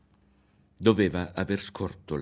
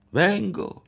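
high, louder reply from afar; calling back; 12 semitones higher, 6 dB louder than usual. A narrator's reading. Ario calls back to the woman, obeying her. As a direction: answer the call, giving in.

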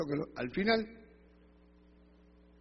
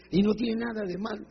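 higher-pitched, irritated comeback; grumbling protest; 4 semitones higher than usual. Retold or imitated. Woman answers her nagging church sister, grumbling. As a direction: push back in a raised pitch, grumbling. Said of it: "Y ella renegaba".

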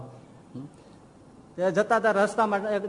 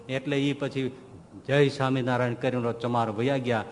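ordinary talk, nothing marked

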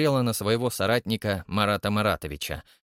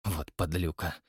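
muttered curse; 6 semitones lower, 7 dB quieter than usual. Acted. Krymov mutters.

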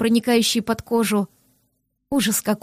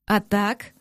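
ordinary delivery, nothing marked

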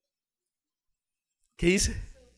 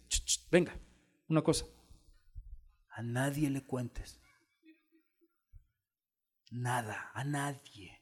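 soft, secretive aside; quiet, confidential warning; hushed confiding; 3 semitones lower, 9 dB quieter than usual. Acted. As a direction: lower your volume as if sharing a secret. Jesus confides a secret to the healed man.

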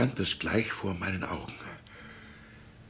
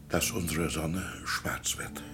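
lower, softer, whispered dread; ominous whisper; 6 semitones lower, 6 dB quieter than usual. Acted. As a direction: breathe the prophecy as if afraid to say it. Pastor reveals the dark prophecy.

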